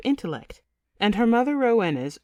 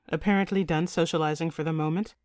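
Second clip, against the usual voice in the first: ordinary delivery, nothing marked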